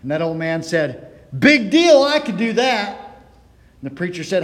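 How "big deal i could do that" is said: loud, higher, slow scoff on Big deal; scornful heckle. Retold or imitated. Old man heckling the preacher again, loud and scornful.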